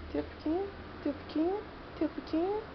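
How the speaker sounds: high, soft, sing-song coaxing; squeaky praising a dog. Real, not acted. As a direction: coax it up high and gentle. Mother coaxing baby, high repeats.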